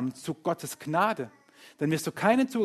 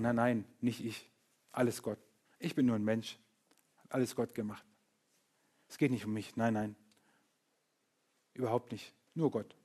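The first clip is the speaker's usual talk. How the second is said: false humility; 5 semitones lower, 5 dB quieter than usual. Retold or imitated. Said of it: "Das ist falsche Demut".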